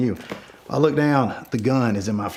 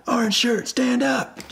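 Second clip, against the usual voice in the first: high, projected order; firm high shout; big commanding voice; 12 semitones higher, slower than usual. Retold or imitated. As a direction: call him out so he has to obey. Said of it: "my big voice".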